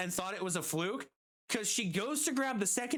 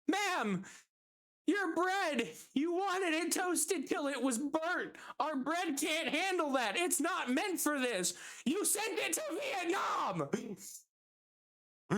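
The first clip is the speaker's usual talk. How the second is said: higher pitched, building to a yell on Vietnam; exasperated shout; 8 semitones higher than usual. Retold or imitated. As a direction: lose your patience with the customer and shout. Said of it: "hears me shout this sentence".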